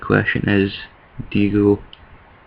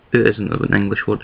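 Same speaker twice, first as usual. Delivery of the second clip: ordinary delivery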